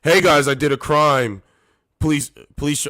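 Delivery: mocking the thief's mindset to the viewers; mock clueless criminal. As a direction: brag about the crime cheerfully. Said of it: "this is his mindset".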